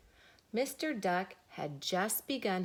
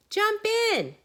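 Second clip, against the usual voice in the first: eager invitation; 13 semitones higher, 7 dB louder than usual. Acted. Pig invites Mister Duck in.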